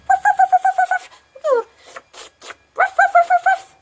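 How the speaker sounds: loud, high-pitched imitated barks; dog barking. Shown as a demonstration. Teacher imitating a dog, loud high barking. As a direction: yap like a small excited dog. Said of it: "we're like".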